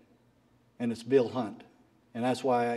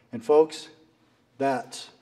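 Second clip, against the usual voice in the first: usual speaking voice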